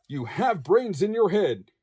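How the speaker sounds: ordinary delivery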